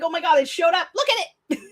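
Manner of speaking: squealing high with glee, a touch mocking; high, gleeful fangirl squeal; nerdy excitement. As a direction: relive the thrill of the book twist. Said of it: "I nerded out so hard".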